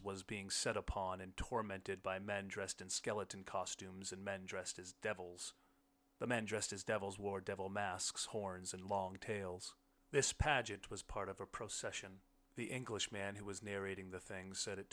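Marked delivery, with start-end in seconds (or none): none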